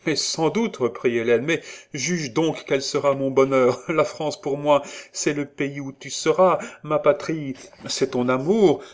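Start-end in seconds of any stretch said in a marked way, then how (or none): 0.1-0.8 high, eager exclamation; rapturous and emphatic; elated insistence. A narrator's reading. Enraptured Hélène presses her point. 1.5-8.8 high, loud, fervent avowal; impassioned, voice held high; rapturous declaration. A narrator's reading. Enraptured Hélène declares her love.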